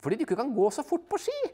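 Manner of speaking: high, loud, fast teasing; wife teasing her husband about skiing. Acted. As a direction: rattle off the jab.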